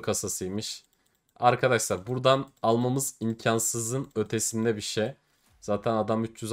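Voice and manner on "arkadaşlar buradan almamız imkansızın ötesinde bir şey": ordinary delivery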